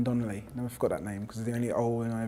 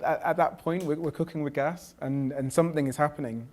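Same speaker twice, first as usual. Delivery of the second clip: normal talking voice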